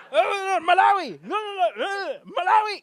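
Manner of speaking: a hint of German, drawn out slow; mock German customs officer, slow and loud; officer reading out the passport in a bad German accent. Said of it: "I can't do a German accent".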